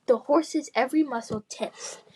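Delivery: plain reading voice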